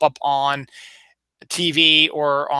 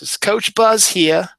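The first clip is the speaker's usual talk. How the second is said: slow, higher Baltimore pitchman line; Coach Buzz impression; 6 semitones higher, 5 dB louder, slower than usual. The commercial stuck in his head, Baltimore pitchman voice. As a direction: stretch it out like the old auto parts ad. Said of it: "whenever I hear Salvo Auto Parts".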